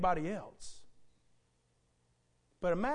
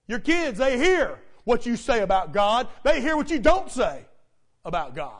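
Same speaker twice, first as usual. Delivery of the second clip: loud, punched preacher's repeat; forceful pulpit voice, hammering each phrase; emphatic preaching; 4 semitones higher, 10 dB louder than usual. Real, not acted. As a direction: drive the point home loudly.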